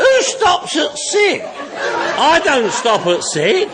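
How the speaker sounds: high, slow words through a laugh; cracking up at his own line. Real, not acted. To the meeting about stopping at sick, laughing hard.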